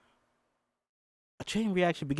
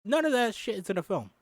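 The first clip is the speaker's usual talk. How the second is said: indignant; 8 semitones higher than usual. Real, not acted. Fan protesting to viewers that none of it is in the film. Indignant about script changes.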